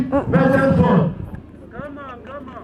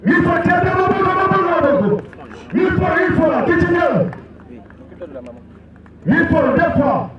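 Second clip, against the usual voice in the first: auctioneer; 5 semitones higher than usual. Acted. Auction chant calling bids.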